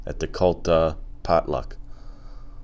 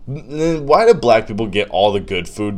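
ordinary delivery

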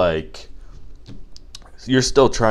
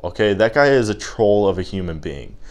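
ordinary delivery

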